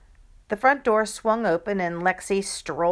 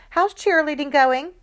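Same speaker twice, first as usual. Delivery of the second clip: pointed cheery question; 8 semitones higher, 6 dB louder than usual. Acted. Mom teasing pointed question.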